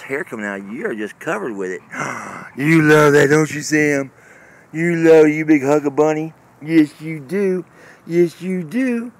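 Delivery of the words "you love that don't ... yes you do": much louder, gushing at the dog; loud doting praise. Real, not acted.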